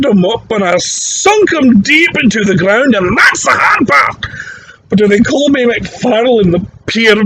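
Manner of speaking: put-on very high, strained old Scottish drunk, aggrieved, slow punchline; an old Scotsman wailing his grievance, then a slow final blow; old Scottish barfly. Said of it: "grabs him and goes".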